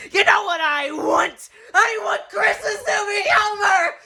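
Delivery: high, loud, desperate shouting; hysterical outburst. Acted. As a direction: scream back at the end of your rope. Screams back at Santa.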